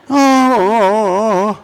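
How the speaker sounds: long, wavering mock groan of disappointment; disappointed "aww". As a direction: echo the crowd's sad "aww". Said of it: "Henry and I were saying the same thing".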